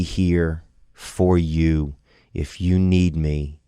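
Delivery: slow down and just stay with them; slow reassuring offer. Retold or imitated. Voicing brother's offer, slowed.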